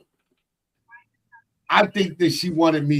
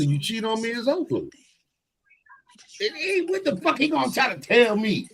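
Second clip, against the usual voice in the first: ordinary talk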